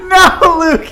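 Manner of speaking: high, loud laughing no; laughing protest. Real, not acted. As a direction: laugh out the denial.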